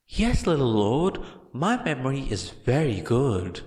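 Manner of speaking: a huge brute fawning on his little master; happy giant. A narrator's reading. Gigantomachia answers proudly.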